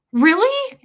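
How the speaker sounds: high, loud delighted disbelief; imitating the new waitress's shocked delight; surprised really. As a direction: jump up on it like she can't believe it. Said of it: "she was like".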